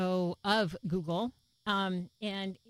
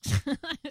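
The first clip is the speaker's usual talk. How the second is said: short laugh; 8 semitones higher, 6 dB louder than usual. Real, not acted. Laughing at barely making it.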